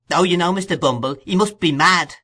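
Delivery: fretful landlady in alarm; alarmed woman. A narrator's reading. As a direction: Mrs Sowerberry fretting to Bumble, high and alarmed. Mrs Sowerberry alarmed.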